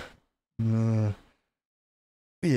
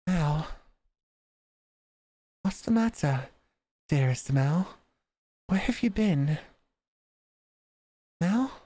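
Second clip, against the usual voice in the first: higher, gentle calling to her brother; sweet sister voice; 6 semitones higher, slower than usual. Acted. Nelly calls out.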